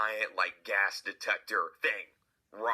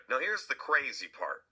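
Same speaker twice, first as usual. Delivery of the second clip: ordinary delivery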